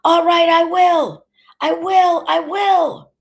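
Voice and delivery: high, loud, drawn-out furious shouts; enraged yelling. A narrator's reading. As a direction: yell the threat back at her. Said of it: "he yelled".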